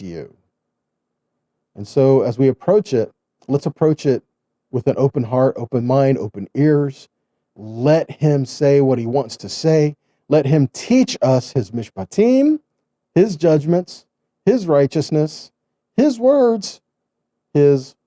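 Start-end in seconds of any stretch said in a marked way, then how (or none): none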